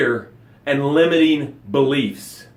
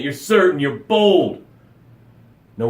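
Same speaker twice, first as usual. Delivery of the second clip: normal talking voice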